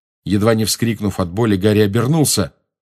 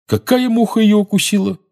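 high-pitched, put out; agitated, badly faked nonchalance; feigned indifference; 13 semitones higher, 4 dB louder than usual. A narrator's reading. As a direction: act unbothered and fail at it. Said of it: "безуспешно пытаясь сделать вид".

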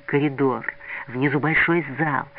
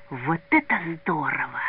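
ordinary delivery

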